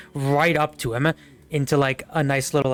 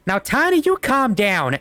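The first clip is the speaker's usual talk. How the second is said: much higher, louder cowboy hollering at his mount; scolding cowboy; 8 semitones higher, 4 dB louder than usual. Acted. Cowboy hollering at Tiny the catfish while riding it. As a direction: rein in a bucking beast with a yell.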